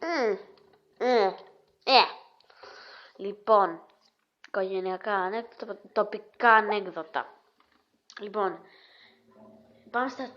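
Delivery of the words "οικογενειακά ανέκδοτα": usual speaking voice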